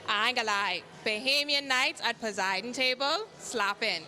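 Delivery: casual Bahamian dialect, lively. Real, not acted. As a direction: speak it island style, Bahamian dialect. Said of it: "use my Bahamian dialect".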